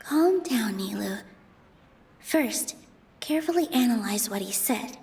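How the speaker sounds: quiet coaching. Acted. Coaching Nilou quietly in the middle of the debate, soft and steadying. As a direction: talk her down gently and softly.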